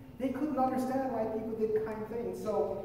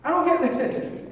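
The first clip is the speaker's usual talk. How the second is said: louder, higher baffled cry; exasperated repeat; 7 semitones higher, 6 dB louder than usual. Retold or imitated. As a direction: throw up your hands, louder. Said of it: "They're like".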